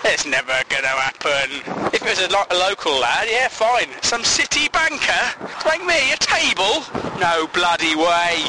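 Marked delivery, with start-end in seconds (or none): 1.9-8.4 lightly put-on cockney, high on table; cockney accent. Playing a local customer refusing the banker, mild cockney.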